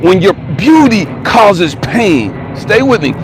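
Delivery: high-pitched, forceful sermon delivery; impassioned preaching. Real, not acted. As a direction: press the point on the congregation. Preaches the story's lesson to viewers.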